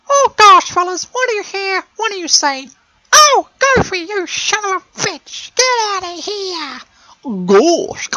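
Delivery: mock the host as a shrill cartoon mouse; [name] as Mickey Mouse. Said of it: "He sounds like a Tully and Mickey Mouse".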